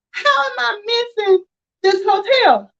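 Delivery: high, exasperated whine; frustrated whine. Retold or imitated. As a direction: plead at the hotel you can't find. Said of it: "'cause I was so frustrated".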